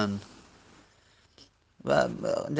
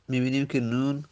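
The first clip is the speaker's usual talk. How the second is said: ordinary talk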